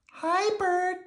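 put-on very high, little-girl puppet voice; sweet falsetto child; Prairie Dawn. A narrator's reading. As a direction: ask politely in a tiny high squeak. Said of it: "Prairie Dawn asked".

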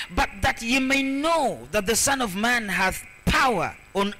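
forceful, measured scripture reading with drawn-out key words; declaimed scripture. Acted. Preacher reading the healing story to the congregation, declaimed. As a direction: read the Bible aloud like a preacher proclaiming it.

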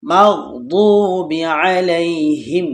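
slow, drawn-out tajweed chant; slow melodic Quran recitation. Shown as a demonstration. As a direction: intone each word slowly. Teacher recites Quran slowly.

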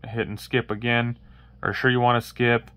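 ordinary talk, nothing marked

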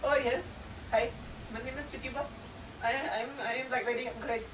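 put-on high, caricatured accented character voice; squeaky mock-accented Tituba. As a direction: imitate a hammy stage reading of Tituba. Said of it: "I went".

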